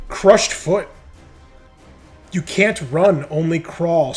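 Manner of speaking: ordinary talk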